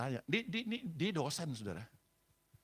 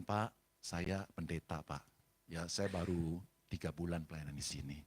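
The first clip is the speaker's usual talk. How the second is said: low, slow and subdued; meek introduction; 13 semitones lower, 5 dB quieter than usual. Retold or imitated. New pastor introducing himself to a hostile husband, meek. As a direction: introduce yourself humbly in a low voice.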